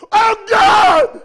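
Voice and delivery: very high, piercing shout to God; explosive pleading scream; anguished cry. Real, not acted. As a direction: cry out at full force in prayer. Preacher crying out in prayer.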